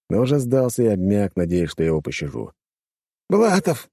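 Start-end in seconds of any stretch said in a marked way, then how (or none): 3.3-3.9 high desperate shout. A narrator's reading. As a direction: yell the name in despair. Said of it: "закричал он".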